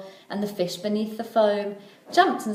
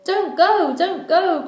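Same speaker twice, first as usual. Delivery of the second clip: much higher, louder bird cries; crying seagulls; 9 semitones higher, 6 dB louder, slower than usual. A narrator's reading. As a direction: call out a frantic warning. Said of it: "cried the seagulls".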